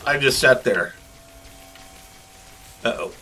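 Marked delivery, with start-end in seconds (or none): none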